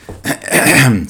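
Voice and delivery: clears phlegm from the throat; a rough throat clear. Real, not acted. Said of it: "Sorry for all the coughing and throat clearing".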